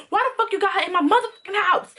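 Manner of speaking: fast, high-pitched accusation; retold angry demand. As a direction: fire the question out, high and quick. Acted quarrel with boyfriend.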